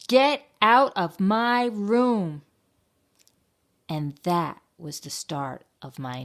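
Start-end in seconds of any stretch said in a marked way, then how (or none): 0.1-2.4 put-on slow, loud, high-pitched child's yell; angry shout. A narrator's reading. Bella finds Bob crawling around her room, shouting. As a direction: yell it word by word. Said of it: "So I shouted".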